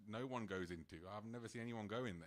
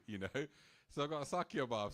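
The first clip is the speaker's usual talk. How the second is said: ordinary talk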